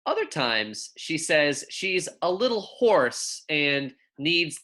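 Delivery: put-on clear, measured picture-book reading for young kids; reading to children. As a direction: read it gently to second graders, savouring each pun. Reads picture book aloud.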